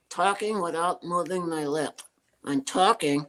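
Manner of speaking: talking without moving the lips; ventriloquist voice. Shown as a demonstration. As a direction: lips still, words pushed through. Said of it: "I'm talking, no lip movement".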